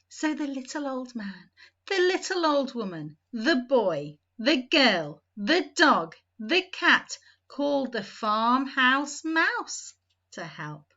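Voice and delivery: plain reading voice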